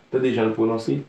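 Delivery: usual speaking voice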